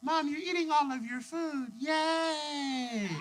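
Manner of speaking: put-on high, soft child voice; imitated sing-song small girl; little girl. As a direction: cheer like a four-year-old, drawn out. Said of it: "little girl looks at her mom and is like".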